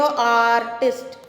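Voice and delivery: आर्टिस्ट said slowly, every sound drawn out; आर्टिस्ट spoken slowly as a pronunciation model; slow model word. Shown as a demonstration. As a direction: stretch आर्टिस्ट so the opening vowel sound is clear. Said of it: "आर्टिस्ट को हिंदी में लिख के देख लो".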